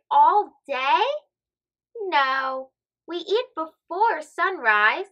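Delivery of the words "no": ordinary delivery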